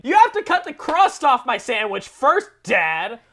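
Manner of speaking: put-on a cranky toddler refusing lunch. Plant voiced as a cranky toddler demanding crusts off. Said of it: "Like a cranky toddler refusing to eat".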